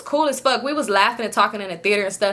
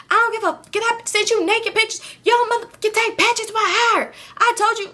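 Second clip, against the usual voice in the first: high, heated retelling of the fight; high-pitched mock outburst at her boyfriend; heated retold rant; 10 semitones higher than usual. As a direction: pitch up and vent at him in mock fury.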